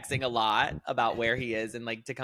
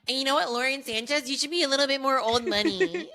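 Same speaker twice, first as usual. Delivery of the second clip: high-pitched, mock-serious advice to a celebrity; mock lecturing; 11 semitones higher, 3 dB louder than usual. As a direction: lecture her in a higher put-on voice. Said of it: "Me sitting here being like".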